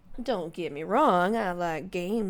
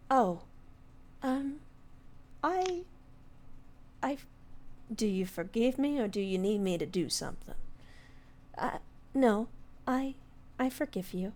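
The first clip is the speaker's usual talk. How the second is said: higher, hesitant little fairy voice; timid fairy; 4 semitones higher, 4 dB quieter than usual. Acted. Coriander admitting fear to the lord, higher and timid. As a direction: stammer like a small shy fairy.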